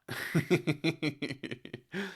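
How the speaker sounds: long run of light, high chuckles; amused chuckle. Real, not acted. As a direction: giggle helplessly in quick little pulses. Overwhelmed by a long comment.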